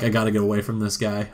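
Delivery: rushed, wanting to escape; fast panicked mutter. Pretend. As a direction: rattle it off in a hurry. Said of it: "And she's just like".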